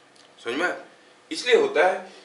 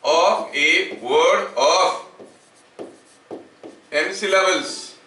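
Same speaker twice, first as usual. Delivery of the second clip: very slow, drawn-out words with long pauses; slow dictation; much slower than usual. Acted. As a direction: dictate piece by piece for note-takers. Dictates the definition for writing. (धीमे-धीमे लिखवाते हुए)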